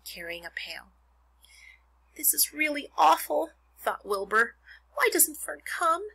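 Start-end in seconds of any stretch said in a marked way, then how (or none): 2.2-3.5 high, plaintive voice of a pig in trouble; small pig near tears. A narrator's reading. Wilbur the pig cornered and about to cry, high plaintive voice. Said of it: "He began to cry". 4.9-6.1 high, slow plaintive question; small pig near tears. A narrator's reading. Wilbur the pig longing for Fern, high slow plaintive voice. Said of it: "He began to cry".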